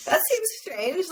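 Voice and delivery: high, slow, mock-puzzled "that seems strange"; incredulous. Real, not acted. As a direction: drag it out, pretending to be baffled. Mocking disbelief at parents.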